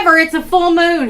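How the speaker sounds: loud high exclaim. Pretend. Silly howl bit.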